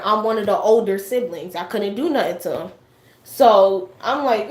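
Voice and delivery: ordinary talk, nothing marked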